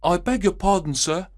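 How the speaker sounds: put-on high, boyish page boy apologising. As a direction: pitch it up like a young page boy. Page boy greets returning Holmes.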